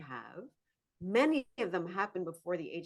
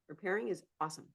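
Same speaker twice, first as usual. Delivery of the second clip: usual speaking voice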